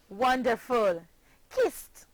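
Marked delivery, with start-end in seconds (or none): 1.5-2.1 "kissed" as one syllable, ending in a t sound. Shown as a demonstration. Teacher models past tense for pupils, "kissed" ending in t. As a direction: finish "kissed" on a voiceless t. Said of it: "Only one syllable".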